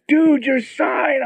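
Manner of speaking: high, slow, stretched-out exclaim; a fan pointing out the sign, thrilled; excited stranger. Retold or imitated.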